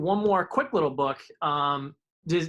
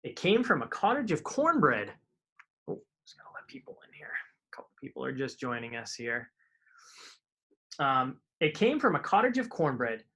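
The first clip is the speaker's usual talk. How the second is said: put-on lively read-aloud for kids; storybook reading; 2 semitones lower, slower than usual. A narrator's reading. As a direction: tell the rhyming tale to children. Reading picture book aloud.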